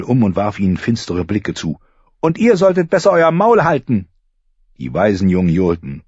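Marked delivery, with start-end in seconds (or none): 2.2-4.1 put-on high, sharp girl's warning; defiant girl. Arya warning the taunting boys, defiant. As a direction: tell them to shut up.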